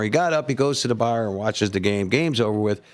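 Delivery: ordinary talk, nothing marked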